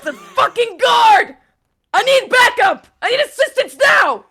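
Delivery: shouted call for backup; urgent shout. Acted. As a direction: call out for help as an officer under attack. Acting officer calling for backup.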